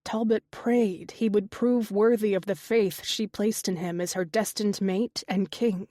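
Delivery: plain reading voice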